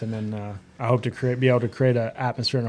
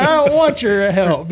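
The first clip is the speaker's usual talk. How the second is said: high, loud retort with a laugh in it; angry retort; 9 semitones higher, 9 dB louder, slower than usual. Retold or imitated. Racer retelling how he refused his rival's help, loud and half laughing. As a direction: snap it back, half laughing. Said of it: "I was so mad".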